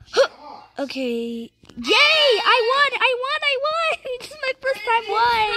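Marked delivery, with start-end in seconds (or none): 1.8-5.5 high, squealing victory cheer; elated. Real, not acted. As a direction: squeal with joy at a first win. Celebrates a first win.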